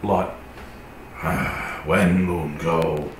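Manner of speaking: slow, broken beast speech; wolf voice. Acted. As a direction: growl it out like a wolf.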